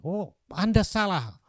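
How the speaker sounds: hammed-up forceful rebuke; harsh criticism. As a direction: act out an attacker accusing from the floor. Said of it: "orang yang mengkritik keras".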